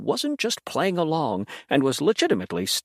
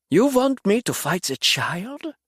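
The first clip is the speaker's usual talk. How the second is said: derision; 8 semitones higher, slower than usual. A narrator's reading. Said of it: "his lips curling in derision".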